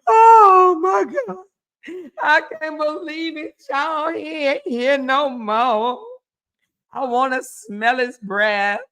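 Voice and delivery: mock bawling. Imagining losing her husband, hammed-up crying. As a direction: pretend to wail over a lost husband. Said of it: "I'd be bawling my eyes out".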